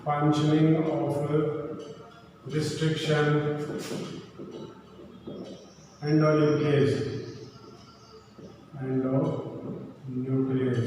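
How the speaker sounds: slow, low, drawn-out words with long gaps; slow dictation. Real, not acted. Teacher writing out a topic title for students, slow dictation. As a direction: dictate it piece by piece while writing.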